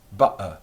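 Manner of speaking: "Butter" with the t dropped, air stopped hard; glottal stop butter. Shown as a demonstration. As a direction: stop the throat in the middle of "Butter". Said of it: "stopping, and starting again".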